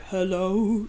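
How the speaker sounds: low-key elegant singer impression. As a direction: imitate a refined singer greeting someone. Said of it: "She sings in this voice".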